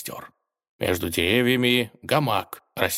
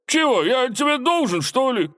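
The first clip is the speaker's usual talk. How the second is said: very high, loud, sulky protest; indignant; 4 dB louder than usual. A narrator's reading. Robber refusing the tsar's dare, indignant. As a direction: protest in an affronted squawk. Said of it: "возмутился соловей и насупился".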